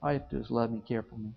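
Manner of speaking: tender reassurance. Acted. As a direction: speak mercy softly and gently. Lord voiced showing love and mercy.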